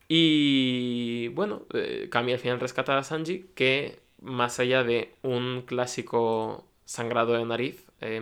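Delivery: ordinary talk, nothing marked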